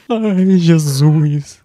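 high, loud startled cry. Real, not acted. Player startled by the dark, crying out. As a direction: gasp it out as something jumps at you. Scared in game.

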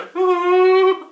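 loud high disbelieving laugh; loud burst of laughter. Real, not acted. Laughing at the article's absurd claim, loud laugh.

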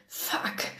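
a voiceless gasped swear through a grimace; gasped curse. A narrator's reading. Burnt on the stove, she gasps the curse. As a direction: pant it out in sudden pain. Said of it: "keuchte ich mit verzerrtem Gesicht".

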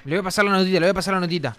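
ordinary talk, nothing marked

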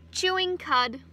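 ordinary talk

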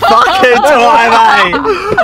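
excited greeting. Real, not acted. Greeting friend at the airport.

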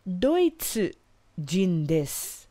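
drawn-out careful Japanese phrase; slow model phrase. Shown as a demonstration. Teacher modelling Kanada jin desu slowly for learners. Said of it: "teacher models German nationality".

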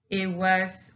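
normal talking voice

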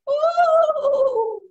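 high, loud ghostly ooh; Mama Ghost wail. Retold or imitated. Spider mimics Mama Ghost, high wail. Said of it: "Mama Ghost goes".

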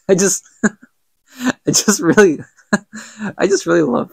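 too amused to finish the phrase; real laughing. Real, not acted. Breaks up laughing at the scene.

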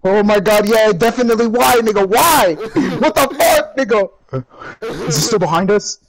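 loud, high, real panic; panicked yelling. Real, not acted. Player fleeing a monster in a game, panicked yell. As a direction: cry out in alarmed disbelief.

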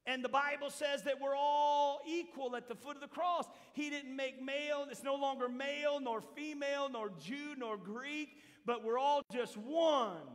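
loud, high, rousing preaching voice; fiery preaching. Acted. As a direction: build the crowd up with fervor. Preaching at full force.

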